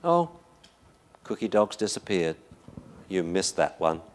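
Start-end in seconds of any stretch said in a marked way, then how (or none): none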